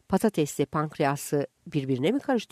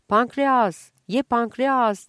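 loud, high, drawn-out street vendor cry; vendor shout; 7 semitones higher, 6 dB louder, much slower than usual. Retold or imitated. Vendor crying his wares to passers-by, loud and high. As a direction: hawk it across the market, loud and stretched. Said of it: "diye bağırdı".

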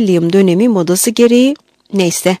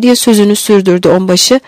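ordinary delivery, nothing marked

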